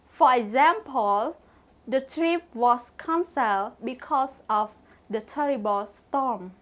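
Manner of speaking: ordinary talk, nothing marked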